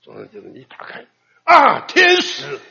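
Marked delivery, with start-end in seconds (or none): 1.4-2.6 mock delighted cry. Song Shangjie greets plain sister.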